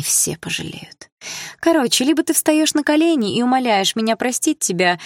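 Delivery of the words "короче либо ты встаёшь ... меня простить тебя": put-on higher, sneering bully girl; higher mocking schoolgirl threat; mean girl voice. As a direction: taunt her from close up.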